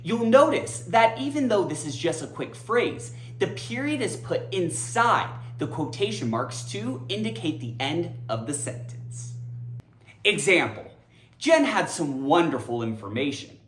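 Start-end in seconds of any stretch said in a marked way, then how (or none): none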